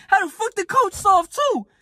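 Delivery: mock outraged. Retelling his rage at the coach, high outraged squeal. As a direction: squeal it in disbelief.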